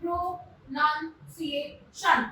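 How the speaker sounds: pronunciation slowly, each syllable set apart. Shown as a demonstration. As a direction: sound out pronunciation as pro, nun, ci, ation. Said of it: "यहाँ पे इसका प्रोनाउंसिएशन बनाया".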